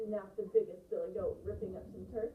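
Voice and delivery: put-on lively storyteller reading to children; expressive read-aloud. Shown as a demonstration. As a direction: read with full voice and shaped phrases. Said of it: "think about voice as I read this".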